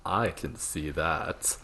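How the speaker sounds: slow, soft smarmy purr; oily blackmailer purr. Retold or imitated. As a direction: purr it slowly, all false sympathy. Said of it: "this very smarmy voice replies".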